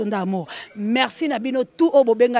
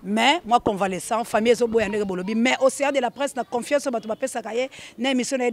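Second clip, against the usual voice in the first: ordinary talk, nothing marked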